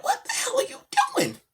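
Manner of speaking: very high, squeaky disbelief; exasperated mock scolding. Scolding the millennial over social media, high squeaky exasperation. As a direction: voice climbs into a thin, comic squeal.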